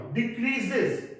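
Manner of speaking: ordinary delivery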